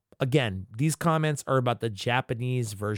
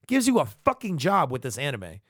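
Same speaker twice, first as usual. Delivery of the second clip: ordinary talk, nothing marked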